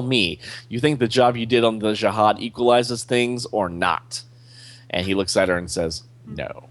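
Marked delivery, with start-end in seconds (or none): 6.3-6.6 a tiny, much softer no; small regretful no. Acted. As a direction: let the no shrink with regret. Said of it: "He makes the no very small".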